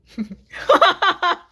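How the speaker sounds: loud, high burst of laughter; self-amused cackling laugh; real laugh. Real, not acted. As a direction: crack up at your own attempt. Laughs at own accent attempt.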